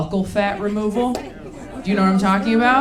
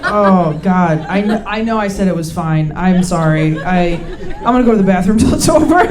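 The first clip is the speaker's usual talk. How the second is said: embarrassed panic; 3 semitones lower, 5 dB louder than usual. Retold or imitated. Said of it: "You're like".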